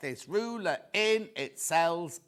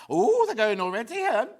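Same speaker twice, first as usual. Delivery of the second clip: odd surprised tone; 7 semitones higher, 7 dB louder than usual. Real, not acted. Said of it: "I don't know why I said it like that".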